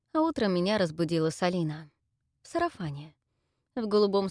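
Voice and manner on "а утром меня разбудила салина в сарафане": plain reading voice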